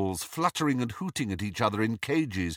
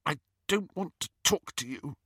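put-on tight, softer, tearful girl; constricted voice; 6 semitones higher, 4 dB quieter than usual. A narrator's reading. Lily after crying, talking to Snape, constricted. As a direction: speak through held-back tears. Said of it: "she said in a constricted voice".

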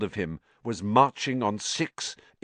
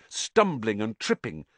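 ordinary delivery, nothing marked

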